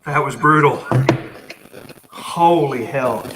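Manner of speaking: loud, slow relief; relieved loud exhale. Real, not acted. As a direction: blurt it out, drawn out, relieved. Back inside after cold run.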